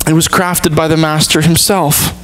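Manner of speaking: ordinary talk, nothing marked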